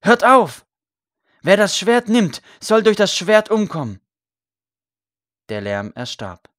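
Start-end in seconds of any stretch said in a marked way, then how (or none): 0.0-4.0 high, very loud commanding cry; shouted rebuke. A narrator's reading. Condemned priest orders the farmers to stop, loud shout. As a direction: shout down the rioting crowd. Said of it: "rief er".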